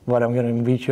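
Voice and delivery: normal talking voice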